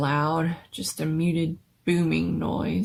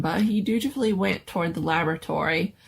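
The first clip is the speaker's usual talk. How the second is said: usual speaking voice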